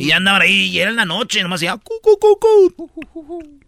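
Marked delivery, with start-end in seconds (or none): 1.8-3.6 ulular agudo de búho; búho imitado. Retold or imitated. (imitación aguda de un búho en la sierra)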